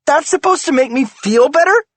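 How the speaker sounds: high, loud shrieking retort. A narrator's reading. Wife shrieks at husband's excuse. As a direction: shriek it back at him. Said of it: "she shrieks".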